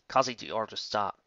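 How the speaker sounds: usual speaking voice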